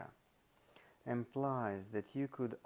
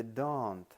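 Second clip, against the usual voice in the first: "don't" punched louder, falling; stress on DON'T; 5 dB louder than usual. Shown as a demonstration. Lecturer showing which word is emphasized, with stress on "don't". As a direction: lean hard on "don't". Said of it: "is emphasized".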